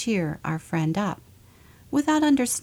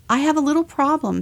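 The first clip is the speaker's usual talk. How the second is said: higher, louder, faster bear; pleading bear; 9 semitones higher, 6 dB louder, faster than usual. Acted. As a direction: blurt out the problem. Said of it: "said the bear".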